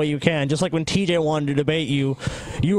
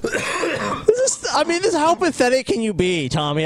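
exasperated; 8 semitones higher, slower than usual. Real, not acted. Exasperated after the outburst.

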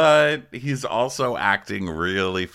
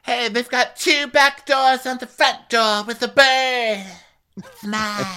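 very high, louder and slow, grabbing the house; high Jennifer impression; 6 dB louder, slower than usual. Claiming the rental house, very high and loud. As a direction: squeak it out slowly, claiming it. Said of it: "She's like".

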